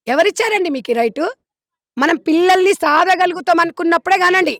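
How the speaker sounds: loud, emphatic admonishing; impassioned appeal. Real, not acted. Visitor urging parents not to orphan children, impassioned.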